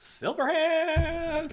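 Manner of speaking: high squeaky drawn-out shout. Acted. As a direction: squeal the name out loud and slow. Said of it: "a squeaky voice".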